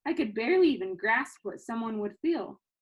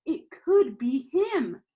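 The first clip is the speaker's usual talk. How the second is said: stunned realization; 5 semitones higher, 6 dB louder, much slower than usual. A narrator's reading. Realization, slow and loud.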